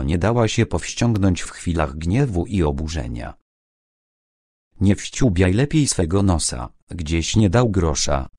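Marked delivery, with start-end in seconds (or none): none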